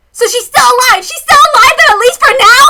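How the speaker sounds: high, loud, eager; very high excited outburst; desperate hope. Acted. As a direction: grab onto the good news, loud and high. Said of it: "Ren and I both latched onto".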